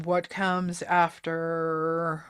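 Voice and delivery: normal talking voice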